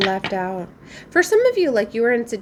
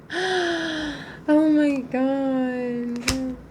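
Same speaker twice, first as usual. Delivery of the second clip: sultry narrator; 7 semitones higher, much slower than usual. Real, not acted. Awed at the cards.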